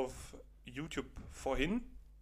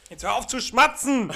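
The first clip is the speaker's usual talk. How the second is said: higher, loud telling-off; loud rebuke; 8 semitones higher, 12 dB louder than usual. Real, not acted. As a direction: snap at him to stop. Telling friend to stop smacking.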